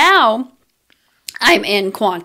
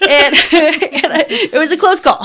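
laughing through the words; real laughter breaking into the line; 6 semitones higher, 3 dB louder than usual. Real, not acted. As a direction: giggle while you tell it. Laughing about surviving.

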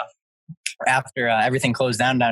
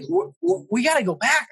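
high-pitched stammering mock panic; retold panic; 14 semitones higher than usual. Friend needing his gear back, retold panic. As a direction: stumble into it high, imitating an alarmed friend.

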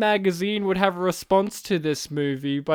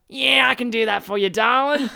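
a lightly voiced Australian guy, a bit louder; Aussie bloke; 6 semitones higher, 5 dB louder than usual. Australian guy offering help to a woman, in a mild Aussie accent. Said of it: "Just in a guy saying it in a really normal way".

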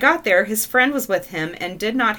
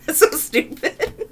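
slow, higher, through giggles; laughing; 5 semitones higher, slower than usual. Real, not acted. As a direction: let the laugh carry the words. Laughing at the absurd story.

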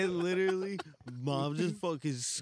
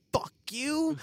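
mom cursing; 10 semitones higher, 4 dB louder than usual. Retold or imitated.

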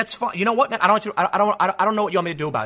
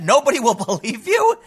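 higher mock quote that breaks into a laugh; amused, higher mock delivery; 6 semitones higher than usual. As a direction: laugh through the punchline. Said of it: "And he said".

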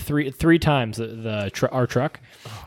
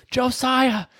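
calling out; 8 semitones higher than usual. Retold or imitated. Patient calling medic across room.

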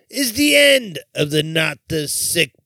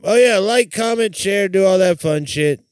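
usual speaking voice

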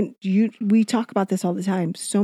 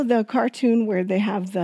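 normal talking voice

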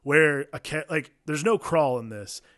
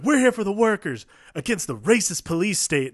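higher, louder rally cry; rousing rebel speech; 6 semitones higher, 6 dB louder than usual. Retold or imitated. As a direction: shout it like a protest leader. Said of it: "she's like".

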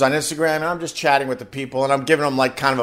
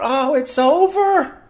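mock despair; 11 semitones higher, slower than usual. Lazing after Christmas.

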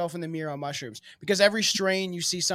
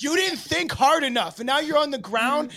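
mock scolding; 5 semitones higher, 6 dB louder than usual. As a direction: scold him like he failed. Mock scolding of a fallen man.

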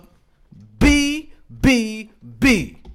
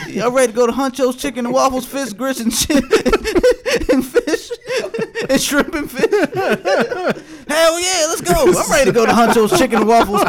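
mock commercial jingle; 6 semitones higher, 4 dB louder than usual. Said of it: "And that's a commercial".

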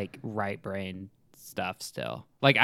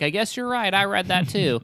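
mock kids agreeing; 10 semitones higher, 5 dB louder than usual. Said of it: "And the kids are like".

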